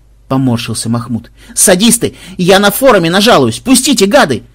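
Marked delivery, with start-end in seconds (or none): 1.5-4.4 high, loud desperate shout; panicked yell. Acted. Captive screams at his torturers, panicked yell. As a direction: scream to be let go.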